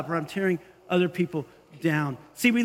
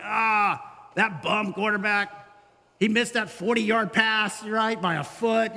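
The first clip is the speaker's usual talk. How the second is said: slow, scoffing couch-potato grumble; armchair critic; slower than usual. Retold or imitated. Lazy boy on his couch mocking the quarterback, slow scoffing grumble. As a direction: drawl it like a sneering armchair quarterback. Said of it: "this lazy boy".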